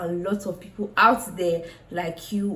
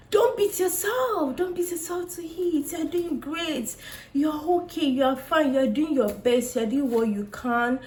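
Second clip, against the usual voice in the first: reassuring; 7 semitones higher than usual. Real, not acted. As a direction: gently reassure a struggling friend. Consoling sad viewers.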